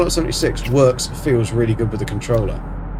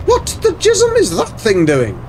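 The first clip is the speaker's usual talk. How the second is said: very high-pitched astonished question; high squeal of disbelief, falling at the end; startled disbelief. Real, not acted. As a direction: exclaim it high, baffled by what you see. Startled by something in the game.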